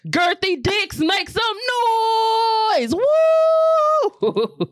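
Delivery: high hype call ending in a very high woo; hype shout. Real, not acted. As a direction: hype the crowd like a DJ.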